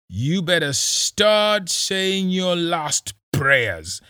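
high, loud, slow gloating threat; menacing police boss. Acted. Kisii gloating over the captives before shooting, high and slow. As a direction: gloat slowly over your helpless prey.

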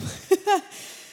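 quick airy burst of laughter; a nervous little laugh breaking off the story; short laugh. Real, not acted. Sharing testimony at church, short airy laugh.

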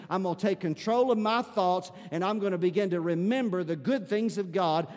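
ordinary delivery

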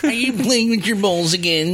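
high nagging mother voice; nagging mom. Pretend. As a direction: scold like a Queens mom. Voicing mom.

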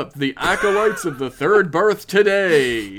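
loud mock cult pitch; cult recruiter. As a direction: hype it like a cult ad.

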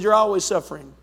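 low, lightly voiced weary God; God's dry reply. God answering the whiny believer, lightly voiced low and dry. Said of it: "The Lord's looking out of heaven and said".

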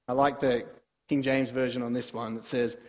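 ordinary talk

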